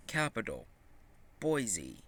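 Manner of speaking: usual speaking voice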